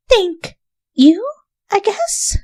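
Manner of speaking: very high, doubtful thanks; unsure Rarity. A narrator's reading. Rarity answers Rainbow's odd compliment, unsure. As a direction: rise into puzzled thanks.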